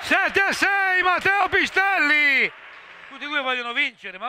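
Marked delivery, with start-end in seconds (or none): 0.0-2.5 high shouted goal commentary; goal call. Real, not acted. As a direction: yell the goal, then the score. Goal called out.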